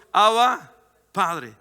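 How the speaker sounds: loud invocation that drops at the end; calling out. Acted. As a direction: call loudly on the Father, then ease off. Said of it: "de llamar a nuestro".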